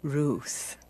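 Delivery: soft firm whisper. Acted. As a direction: press the point under your breath. Naomi presses Ruth quietly.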